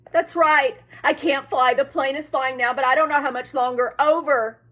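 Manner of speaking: loud, high, frightened radio reply; urgent panic. A narrator's reading. Boy urgently answering radio operator.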